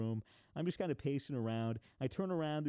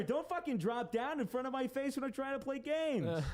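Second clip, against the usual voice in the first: mock scolding; 12 semitones higher than usual. Scolding the spider in mock anger.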